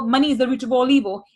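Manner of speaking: normal talking voice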